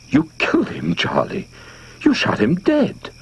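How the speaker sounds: high, accusing outrage; injured justice. A narrator's reading. A neighbor woman accuses Charlie of murder, high and outraged. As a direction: accuse him, wounded and righteous. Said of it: "voice was that of injured justice".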